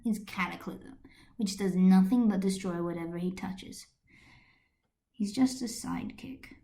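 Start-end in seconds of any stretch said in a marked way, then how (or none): 5.1-6.6 soft, unvoiced, sad aside; a quiet, breathy line with sadness held back; hint of sadness. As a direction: whisper it with hidden hurt. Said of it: "a hint of sadness hidden in his voice".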